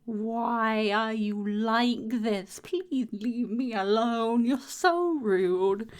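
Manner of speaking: slow, drawn-out mock complaint; mock whiny. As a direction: whine at the rude enemy, hammed-up. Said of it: "So I'm like".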